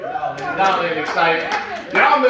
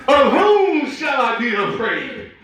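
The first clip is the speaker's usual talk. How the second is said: slow, stretched phrases climbing higher; fervent scripture reading; 5 semitones higher, slower than usual. Acted. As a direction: proclaim the psalm with growing fire. Psalm read with rising fervor.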